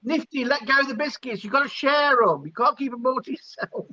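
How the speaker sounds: high-pitched mock telling-off; playful scolding. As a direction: scold jokingly, as if prising something away. Playfully telling Nifty to share biscuits.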